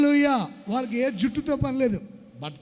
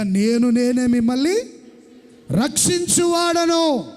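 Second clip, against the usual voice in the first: loud, ringing sermon voice; fervent preacher blessing the church; preaching; 3 semitones higher, 3 dB louder than usual. Acted. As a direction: proclaim it to the congregation. Preacher booms scripture and blessings.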